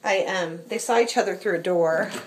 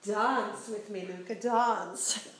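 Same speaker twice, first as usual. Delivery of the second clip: slow singsong; 6 dB quieter, much slower than usual. Real, not acted. Dancing with the cat.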